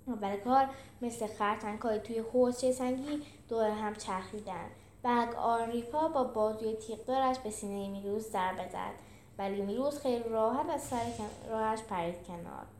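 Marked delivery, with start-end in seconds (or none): none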